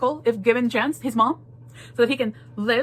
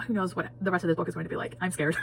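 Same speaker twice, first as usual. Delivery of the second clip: ordinary delivery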